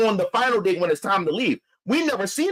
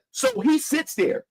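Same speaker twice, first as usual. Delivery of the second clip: usual speaking voice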